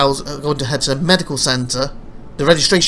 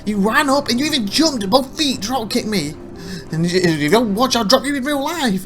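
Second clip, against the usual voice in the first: mock indignant; 6 semitones higher than usual. He argues back with Tiffy.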